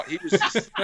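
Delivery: usual speaking voice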